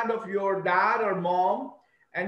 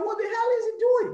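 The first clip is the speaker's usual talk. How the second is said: much higher, fast, baffled mother; puzzled mom; 11 semitones higher, much faster than usual. Pretend. Mom reacts to her son mimicking accents, high and baffled. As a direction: squeak it like a startled mom. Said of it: "Mom will say".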